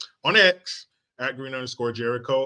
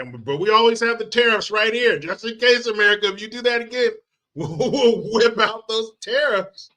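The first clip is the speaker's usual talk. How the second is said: mock tough talk; 6 semitones higher than usual. Mocking Canada's tough-guy threat.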